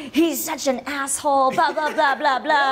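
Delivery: a touch of hammed-up anger; mock angry. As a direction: vent at him, dramatic but light. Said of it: "I'll first be angry".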